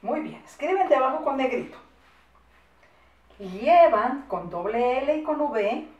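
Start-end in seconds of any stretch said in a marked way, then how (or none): 3.4-5.9 loud, slow, spelling as you go; drawn-out word, then its spelling; slow dictation. Shown as a demonstration. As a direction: dictate firmly at writing speed.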